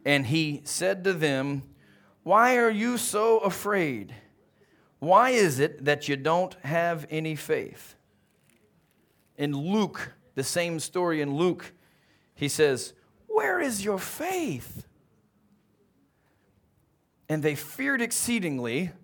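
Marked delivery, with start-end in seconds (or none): 2.2-8.0 slow rebuke. Acted. Jesus rebuking fearful disciples. 13.3-14.9 slow gentle rebuke. Acted. Jesus rebukes in Luke.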